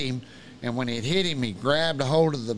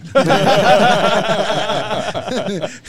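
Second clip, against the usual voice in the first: loud, long burst of laughter; high, sustained real guffaw; hearty laugh; 7 semitones higher, 10 dB louder than usual. Real, not acted. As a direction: crack up at your own story. Laughs at own story.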